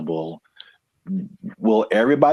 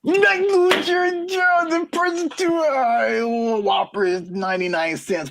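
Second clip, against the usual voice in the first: mocking squeaky voice. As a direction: squeak it out like a cartoon character.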